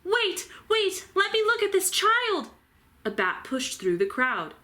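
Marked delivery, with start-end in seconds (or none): 0.0-2.5 high, urgent bat voice; insistent bat calling for room; eager bat. A narrator's reading. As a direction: push forward, very high and eager. Eager old bat pushing through.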